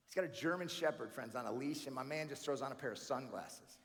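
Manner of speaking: ordinary talk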